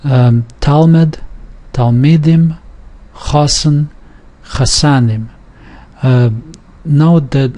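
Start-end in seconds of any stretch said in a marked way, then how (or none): 0.6-2.6 Talmud on "Tal", Talmidim on "mid"; shifting stress. Shown as a demonstration. Teacher showing when stress moves, shifting stress. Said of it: "when it does move". 3.3-5.3 Chosen on "Cho", Chasanim on "san"; shifting stress. Shown as a demonstration. Teacher showing Hebraic words, shifting stress. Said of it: "does shift".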